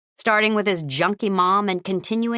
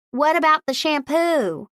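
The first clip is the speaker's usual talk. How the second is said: high-pitched holler down the street; hollered question; 9 semitones higher than usual. Acted. Shop owner calling after departing customers, high holler. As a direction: call after them, pitched up. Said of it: "Maureen hollered".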